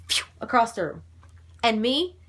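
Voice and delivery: ordinary talk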